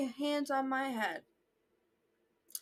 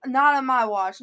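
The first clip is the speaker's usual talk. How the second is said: mock defiant; 8 dB louder than usual. Joking defiance about the beanie.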